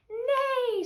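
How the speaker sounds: high, loud falling whinny; horse's neigh. A narrator's reading. Horse after the thieves are caught, high neigh. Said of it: "said the horse".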